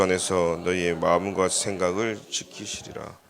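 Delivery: slow, measured scripture reading in an even tone. Real, not acted. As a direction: lead a congregation reading the verse aloud together. Leads joint scripture reading. (또박또박 느리게 성경을 합독하는 어조)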